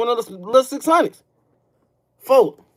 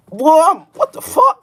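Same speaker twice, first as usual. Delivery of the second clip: shocked; 5 semitones higher, 5 dB louder than usual. Retold or imitated. Retelling stepping out on pills.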